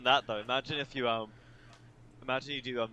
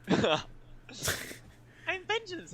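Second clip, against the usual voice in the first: much higher, slow mock growl, laughing; Batman line; much slower than usual. S1 repeats the Batman line, hamming it up. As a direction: drag out the hero's catchphrase. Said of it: "Batman twenty twenty-three".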